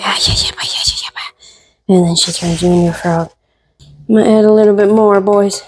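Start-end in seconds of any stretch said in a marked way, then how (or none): 0.0-2.0 breathy whispered witch's yeah-yeahs; witch's mock whisper. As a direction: whisper it like a witch over her pot, lightly acted. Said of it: "she goes like".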